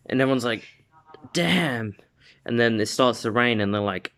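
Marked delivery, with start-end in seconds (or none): 1.3-1.9 higher-pitched, falling acted amazement; awed exclamation. Everyone watching the clouds part after the punch, awed exclamation. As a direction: voice an impressed onlooker.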